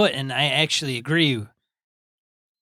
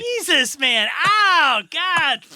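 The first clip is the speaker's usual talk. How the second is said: sharp pained yelp, much higher; cramp pain; 13 semitones higher, 4 dB louder, much slower than usual. Real, not acted. Cramp hits again while reading, real pained yelp. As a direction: cry out as the cramp grabs again. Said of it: "I just got a hellacious cramp in my leg".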